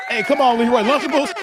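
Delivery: amused protest at a viewer's joke. Real, not acted. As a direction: come on, you got me. Teasing viewer, laughing.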